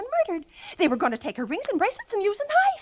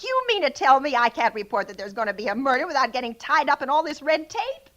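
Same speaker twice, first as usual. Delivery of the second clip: ordinary talk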